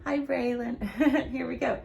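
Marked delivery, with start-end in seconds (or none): none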